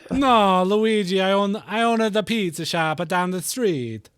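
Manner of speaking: cartoon Italian pizza-shop owner; Italian accent. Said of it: "Now you're, like, Italian".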